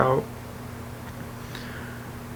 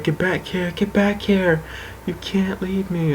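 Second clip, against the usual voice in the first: higher, thin pleading, imitating a stranded sister; mock freaking out; 6 semitones higher, 5 dB quieter, slower than usual. Sister stranded in treehouse calls to brother, mock freaking out. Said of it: "she starts freaking out on me".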